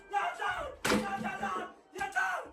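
high, exasperated shouting; fed-up fan screaming at the screen; furious yell. Real, not acted. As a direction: yell it out in disbelief. Conceding goal, furious fan.

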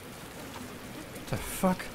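slow breathy whisper. Real, not acted. Sneaking up on strangers, slow whisper. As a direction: hush it so nobody hears.

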